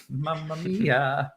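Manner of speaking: slow, hammed-up Italian Mamma Mia; Italian accent. Host jokes about promo reads in Italian accent, slow hammed-up Italian. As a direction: drawl it out like a cartoon Italian. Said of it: "just did it in an Italian accent".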